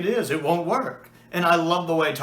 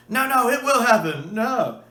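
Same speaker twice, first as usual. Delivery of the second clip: insistent princess; 8 semitones higher, 5 dB louder than usual. Retold or imitated. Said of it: "Xanxia insisting".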